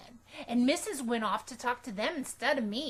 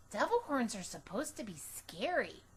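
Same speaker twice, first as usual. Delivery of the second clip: ordinary delivery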